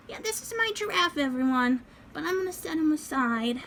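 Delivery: put-on high little kid voice; doll voice. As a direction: speak as the toddler doll. Doll speaks to viewers.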